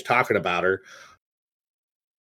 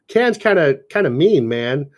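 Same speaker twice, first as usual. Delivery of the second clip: ordinary delivery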